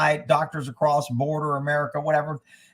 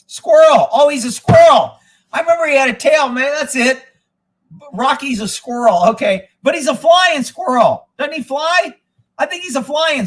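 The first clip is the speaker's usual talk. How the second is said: higher and louder, delighted; excited realization; 9 semitones higher, 8 dB louder than usual. Real, not acted. To the chat about Rocky, excited and much higher. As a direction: lights up at remembering the squirrel.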